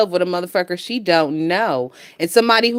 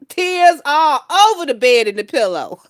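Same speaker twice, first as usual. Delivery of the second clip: usual speaking voice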